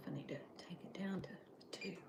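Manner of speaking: quick whispered aside to herself; hushed fast whisper. Real, not acted. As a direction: mutter it under your breath, fast. Quiet aside while cooking.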